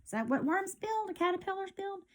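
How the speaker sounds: ordinary delivery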